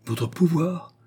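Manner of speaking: embittered, despairing cry; bitter outcry. A narrator's reading. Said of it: "s'écrie le jeune homme, aigri par le désespoir".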